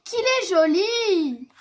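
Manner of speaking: high, loud, drawn-out exclamation; admiring cry. A narrator's reading. Little bear friend crying out behind him at the snowman, admiring. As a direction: cry out in delight, stretching the last word. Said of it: "quelqu'un crie derrière lui".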